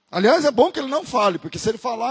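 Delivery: ordinary delivery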